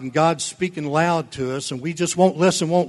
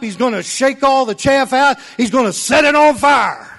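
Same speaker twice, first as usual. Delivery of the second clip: loud, high hellfire warning; fiery preaching shout; 8 semitones higher, 4 dB louder than usual. Retold or imitated. Preacher voicing John the Baptist, loud fiery shout. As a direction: thunder it out like a revival preacher.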